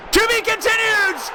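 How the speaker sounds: high, loud goal scream; goal call shout. Acted. Goal scored in game.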